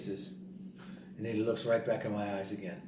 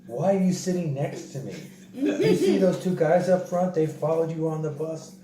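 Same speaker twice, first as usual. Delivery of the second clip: louder, higher, laughing through the words; laughing scold; 6 semitones higher, 7 dB louder than usual. Retold or imitated. Scolding Don for sitting beside him, loud and laughing. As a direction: scold him while cracking up.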